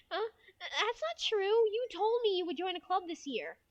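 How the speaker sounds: high schoolgirl voice; anime girl, protesting. Acted. Friend insists on a club promise, high anime girl protest. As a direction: object high as the let-down friend. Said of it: "a regular anime girl voice".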